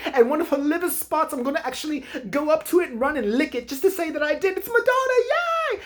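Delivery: high gushing blonde host voice, way up in pitch; mock overexcited host. Comic mimicking the overexcited co-host gushing about [name]. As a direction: gush like a giddy TV host who can't contain herself. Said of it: "she's just too excited about everything".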